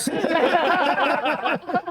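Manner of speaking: loud high burst of laughter; real loud laughter; big laugh. Real, not acted. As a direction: crack up at the punchline. Laughing at own story.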